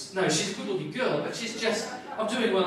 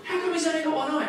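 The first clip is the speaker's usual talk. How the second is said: high, loud alarmed question; new dad in a panic asking the doctor, high and loud; panicked blurt; 8 semitones higher, 4 dB louder, faster than usual. Retold or imitated. Repeats the panicked line.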